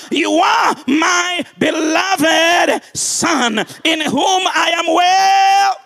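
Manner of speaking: impassioned proclamation. Acted. God's voice to a broken son, climactic preaching shout. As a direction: proclaim it at full force, stretching the key words.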